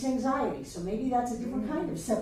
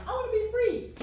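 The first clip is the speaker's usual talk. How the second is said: high, thin, longing plea; small pleading inner voice; 10 semitones higher, faster than usual. Acted. Teacher acting out the inner self pleading to the ego, high small voice.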